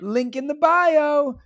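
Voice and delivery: high, loud, drawn-out plug; teacher influencer. Retold or imitated. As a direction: sing out the plug slowly like an influencer. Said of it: "teacher influencer life videos".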